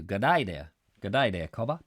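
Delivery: hammed-up Aussie greeting; badly faked Australian accent; terrible Australian accent. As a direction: have a clumsy go at an Australian accent. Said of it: "that was a terrible accent".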